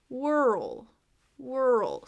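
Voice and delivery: "whirl" drawn out with a dip into the L. Shown as a demonstration. Teacher contrasts it with world, "whirl" dragged and dipping. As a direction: dip through "whirl", nearly two syllables. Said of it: "So it has more of a dip".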